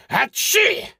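explosive, high-pitched mock sneeze; big comic sneeze; acted sneeze. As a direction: let out a loud, squeaky "achoo". Character sneezes, others say bless you.

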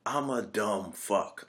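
slow, soft, dejected mumble; slow hushed self-putdown; deadpan self-insult. Retold or imitated. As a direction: mutter it like a beaten-down husband. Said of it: "he's like".

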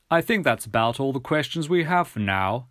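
lightly voiced official gentleman; a hint of a formal English gentleman; measured, mildly officious character voice. Gentleman's dialogue in story narration.

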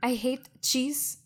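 put-on Frenchwoman's accented English; French accent. French Beth tells visitor she hates cheese, French accent. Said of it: "looks like she is a native in France".

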